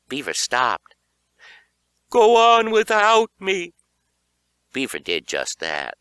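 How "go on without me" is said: high, loud, slow dramatic plea; mock heroic plea. A narrator's reading. Tripped kid telling Beaver to flee, high, loud and slow. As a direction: ham up a noble sacrifice.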